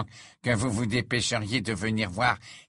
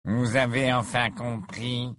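slow, sneering sarcasm; sarcastic; 2 semitones higher, slower than usual. Acted. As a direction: drawl it with a sneer. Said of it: "lança Malfoy d'un ton sarcastique".

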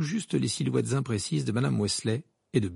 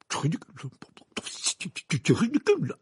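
halting, flustered denial; stammering; 4 semitones higher than usual. A narrator's reading. Minister refuses to believe the news, stammering. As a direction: stumble over the words, then raise your voice. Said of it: "balbutia-t-il les yeux écarquillés".

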